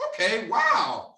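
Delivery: a high, drawn-out wow; impressed interviewer. Retold or imitated. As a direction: sound blown away by the answer.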